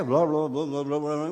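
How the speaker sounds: a lightly imitated dull monotone sermon voice; mock the boring pastor droning on; droning preacher. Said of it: "uma voz de fundo de garrafa, numa entonação qualquer". (imitando de leve um pastor monótono)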